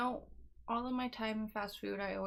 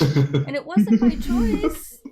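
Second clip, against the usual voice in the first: mock dramatic; 2 semitones higher, 16 dB louder, much slower than usual. Jokingly stressing reluctance.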